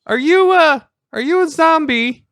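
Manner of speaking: player's character calling to the closed door; yelled call. Acted. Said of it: "I'm gonna yell".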